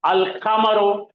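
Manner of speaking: अल कमरो slowly, ल kept clear; slow Arabic reading. Shown as a demonstration. Teacher to class, slowly reading the moon-letter word. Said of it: "यहां पे आ जाइए".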